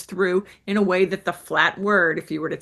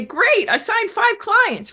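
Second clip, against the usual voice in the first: excited; 14 semitones higher, 5 dB louder, slower than usual. Pretend. Imagined client reports big success.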